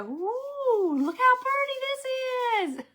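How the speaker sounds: high, drawn-out delighted coo; cooing over fabric. Retold or imitated. Viewer touching fabric in store, high delighted coo. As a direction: swoon as you stroke the fabric. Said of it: "run your hand over it and go".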